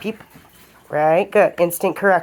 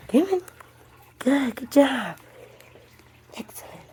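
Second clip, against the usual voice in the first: coaxing the dog; 7 semitones higher, 6 dB quieter than usual. Real, not acted.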